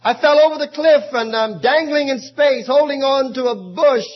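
high, loud desperate begging; panicked man clinging to a bush; frantic plea. Acted. Dangling man pleads with heaven.

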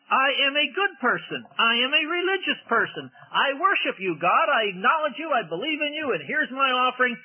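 high, proud and pleased with himself; self-righteous boast. Pretend. Cain's offering boasting to God, high and smug. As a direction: puff up like a man sure he is good.

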